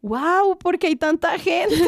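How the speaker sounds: high, loud, wide-eyed wonder; mock amazed idols. Fan imitates idols amazed by the crowd, high and loud. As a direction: play the stunned humble idol. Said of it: "con esa humildad todo el tiempo de".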